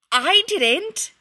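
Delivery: high, loud annoyed snap back; annoyed retort. A narrator's reading. Remus hits back at Sirius in a loud, annoyed high retort. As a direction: fire it straight back, irritated. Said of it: "Remus shot back, sounding annoyed".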